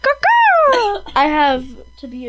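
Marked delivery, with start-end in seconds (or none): 0.0-1.0 bird call. Pretend.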